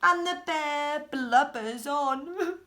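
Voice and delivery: slow mock blubbering; hammed-up crying bear. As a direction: wail it like a sobbing bear.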